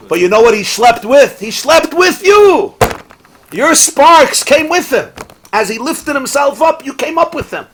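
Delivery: high, loud sermon climax; fervent preaching. Acted. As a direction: preach it out with full force. Preaching climax at full force.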